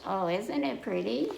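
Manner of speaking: usual speaking voice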